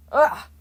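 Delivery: sharp, loud, high Ugh of pain; a god pained to hear his Greek name; pained groan. A narrator's reading. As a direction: cry out as a headache stabs.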